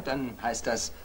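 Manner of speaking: ordinary talk, nothing marked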